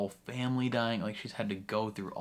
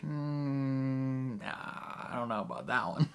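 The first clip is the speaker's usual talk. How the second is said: hesitant refusal; 4 semitones higher, slower than usual. Retold or imitated. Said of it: "And he's like".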